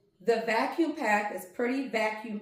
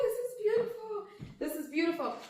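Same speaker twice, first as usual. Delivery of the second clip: laughing; 9 semitones higher, faster than usual. Real, not acted. Repeats softer with laugh.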